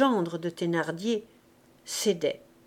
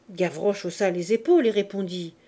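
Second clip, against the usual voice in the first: ordinary delivery, nothing marked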